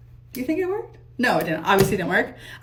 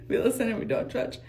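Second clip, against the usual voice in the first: ordinary talk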